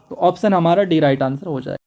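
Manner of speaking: ordinary delivery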